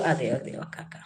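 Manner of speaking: low whispered yes yes okay; whispered aside. Real, not acted. Host whispering an aside during the live quiz. Quick aside while streaming.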